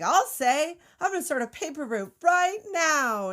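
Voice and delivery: put-on high, loud eager man-child voice; Pee-wee Herman. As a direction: blurt it out high and gung-ho. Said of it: "that's when Pee Wee's like".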